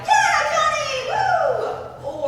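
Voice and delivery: high, loud mock crowd cheer; kids cheering. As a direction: whoop like an excited schoolkid. Acting out kids cheering his entrance.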